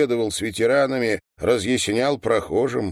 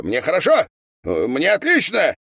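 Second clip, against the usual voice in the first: high, raised assertion; worked-up brushing-off of concern; insistent protest; 8 semitones higher, 5 dB louder than usual. A narrator's reading. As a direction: insist loudly that all is well. Dazed man insists he is fine.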